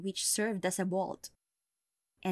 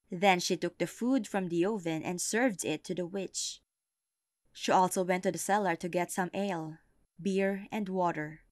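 ordinary delivery

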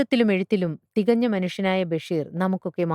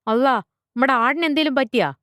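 high, louder, worried outburst; alarmed; 7 semitones higher, 5 dB louder than usual. A narrator's reading. Father asks teacher if his goat is hurt, alarmed. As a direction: jump in with sudden alarm. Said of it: "മറുതലയ്ക്കൽ Muhammad ബേജാറായി".

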